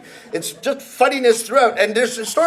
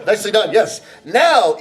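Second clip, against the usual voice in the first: ordinary delivery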